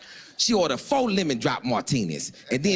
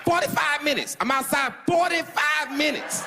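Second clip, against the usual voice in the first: much higher, frantic pitch; high strained voice; 13 semitones higher than usual. Retold or imitated. Narrator waits outside for date, high frantic voice. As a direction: squeak it out in panic.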